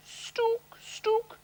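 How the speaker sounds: high stook stook; train whistle. Retold or imitated.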